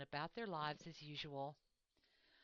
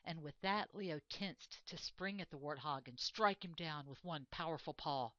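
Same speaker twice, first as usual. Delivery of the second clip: plain reading voice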